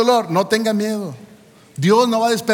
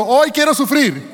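mock cheerful wish; 7 semitones higher, 5 dB louder, slower than usual. Mocking nobody's morning wish.

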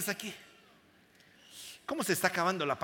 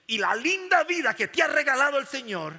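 loud, raised-pitch preacher's swell; full-force preaching; 8 semitones higher, 12 dB louder than usual. Real, not acted. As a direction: lift the voice and proclaim it.

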